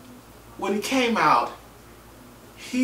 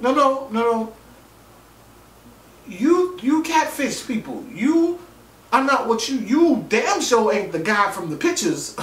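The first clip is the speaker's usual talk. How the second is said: higher, excited disbelief; pitched-up mock scolding; incredulous protest; 7 semitones higher than usual. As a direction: call him out, half laughing. Telling off the catfisher.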